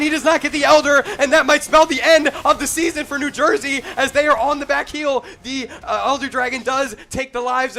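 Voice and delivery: rising excited play-by-play call; live play-by-play. Acted. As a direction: build the hype as the fight pops off. Hypes the decisive team fight.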